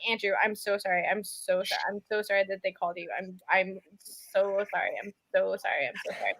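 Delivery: apologetic. Retold or imitated. To Andrew on the phone, soft apology. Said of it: "I'm like".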